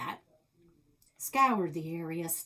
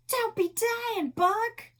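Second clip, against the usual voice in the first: high, loud, slow wail; hammed-up frontier lament; mock wailing grief; 7 dB louder, slower than usual. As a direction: wail over the dying partner. Partner dying in pretend game.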